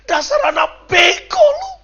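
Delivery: high, loud, drawn-out scolding; sharp-tongued parent. Acted. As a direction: scold the child like a harsh parent. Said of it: "orang tuanya terlalu tajam mulut".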